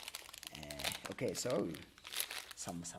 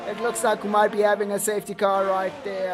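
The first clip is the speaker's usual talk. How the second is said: louder caster voice settling; race commentary; 6 semitones higher, 11 dB louder than usual. Acted. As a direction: keep calling the race. Continuing commentary.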